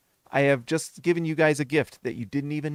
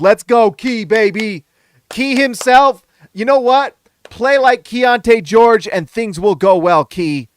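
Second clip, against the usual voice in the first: excited; 5 semitones higher, 9 dB louder than usual. Real, not acted. Hyping a viewer's date.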